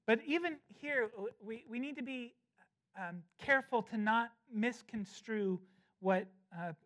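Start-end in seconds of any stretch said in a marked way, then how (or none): none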